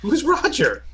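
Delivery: high confused echo. Acted. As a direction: squeak it out, confused. Echoes in mock confusion.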